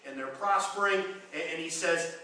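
normal talking voice

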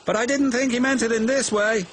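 high-pitched, cheeky character voice; teasing little engine. A narrator's reading. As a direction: needle him in a high voice. Percy teases James after the fire.

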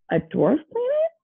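high, rising disbelieving question; mild indignant shout. A narrator's reading. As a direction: echo it back in mild outrage. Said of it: "Pluto shouted".

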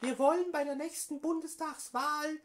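höhere, gespielte Politikerinnenstimme; Frauenstimme. Acted. Prank call as AfD woman. (verstellte Frauenstimme am Telefon)

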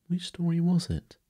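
high, eager questioning. A narrator's reading. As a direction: ask keenly, voice pitched right up. Wendy eager to know the story.

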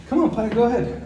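light singsong nudge to a pet; coaxing the dog. Real, not acted. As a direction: sweet-talk the dog up the stairs.

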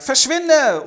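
very high, loud command to get out; furious shout. Acted. Stepmother throwing the maid out, yelling furiously. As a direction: bark her out the door.

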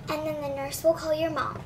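usual speaking voice

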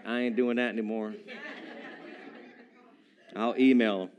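low, subdued deadpan refusal. Acted. Would-be visitor scared off the tombs, deadpan refusal. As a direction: drop low and quietly opt out. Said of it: "Be like".

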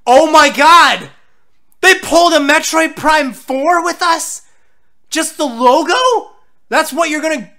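loud, high, drawn-out protest; outraged disbelief. Real, not acted. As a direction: shout it as if cheated. Outraged that only a logo shows.

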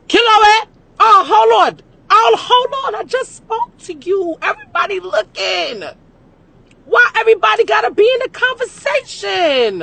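shouting high into the phone; loud phone shouting. Retold or imitated. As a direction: yell over everyone at the table. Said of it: "Every time".

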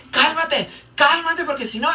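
high mock scolding mother; scolding mom. Street mom scolding her misbehaving child, high and mock-stern. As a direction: threaten the bratty kid like a street mom. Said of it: "esas señoras ... le dice".

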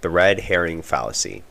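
upbeat scripted host read; podcast intro read. Acted. Scripted podcast intro over music.

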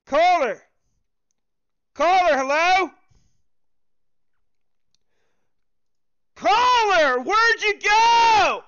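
loud, rising shouts into the silence; calling out. Real, not acted. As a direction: yell after the vanished caller, dragging out the last words. Caller went silent on hotline.

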